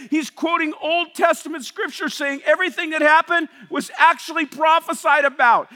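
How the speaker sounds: ordinary delivery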